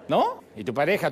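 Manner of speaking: usual speaking voice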